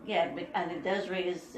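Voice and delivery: normal talking voice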